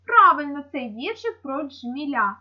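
normal talking voice

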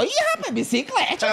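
high squeal. Retold or imitated. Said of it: "eu escuto".

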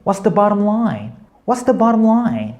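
slow, high model reading of the phrase. Shown as a demonstration. Teacher models the target phrase.

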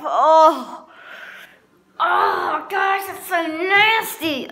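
high, slow groan of disgust. Real, not acted. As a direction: groan like it tastes awful. Disgusted after the bite.

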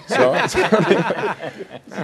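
high, loud real laughter; laughing at himself. Real, not acted. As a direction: crack up over your own botched punchline. Laughs at own botched joke.

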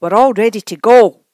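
loud, carrying call. A narrator's reading. Missus Bird calling everyone to go, loudly. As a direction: keep calling to the family across the store. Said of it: "called Missus Bird".

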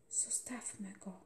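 unvoiced whisper by a sickbed. A narrator's reading. Boka whispers to the mother beside the sleeping boy. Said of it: "odpowiedział szeptem".

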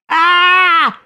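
very high, loud squeal; piercing worm scream of despair; desperate scream. A narrator's reading. Worm struggling in angler's hand, desperate scream. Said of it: "krzyczał rozpaczliwie robak".

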